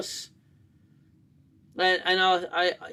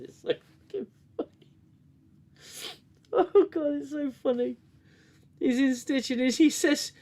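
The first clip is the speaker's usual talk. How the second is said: higher and fast, laughter welling up; overcome with laughter; 10 semitones higher than usual. Real, not acted. Remembering his dying dad's laughing fit, overcome with laughter. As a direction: caught between giggles and tears.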